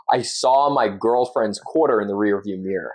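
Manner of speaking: Australian attempting an American accent; careful imitation of an American accent; copying American accent. Shown as a demonstration. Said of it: "and then I'll try and copy you".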